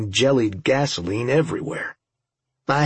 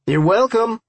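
high-pitched yell; 10 semitones higher, 4 dB louder than usual. A narrator's reading. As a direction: yell it across to the others. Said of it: "I yelled".

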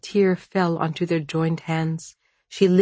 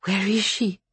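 lightly voiced, higher-pitched dying woman; a higher, weakened plea, understated; 4 semitones higher than usual. A narrator's reading. Dying woman asks Vasya for her child, gently anxious.

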